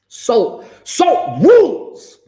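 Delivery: high, slow sing-song "salt rules"; hammed-up pun. Shown as a demonstration. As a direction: stretch it out like a jingle. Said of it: "See, see what I did there?".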